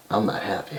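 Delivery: Bret's quiet threat; quiet menace. Retold or imitated. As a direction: mutter it darkly. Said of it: "Bret goes".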